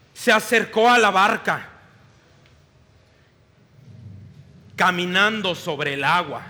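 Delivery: expressive storytelling read of scripture; dramatic scripture reading; Bible story narration. Acted. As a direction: read the Gospel like a vivid story to the congregation. Reads Gospel passage aloud.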